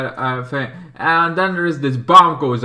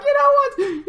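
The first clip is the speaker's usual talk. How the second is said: mock stern mother. Mother scolding the witch daughter.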